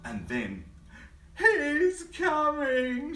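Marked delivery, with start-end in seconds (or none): 1.4-3.1 high, loud, drawn-out shriek; shrieking mouse. Acted. Mouse shrieking that Big Bro is coming. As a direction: shriek the alarm. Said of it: "shrieked the mouse".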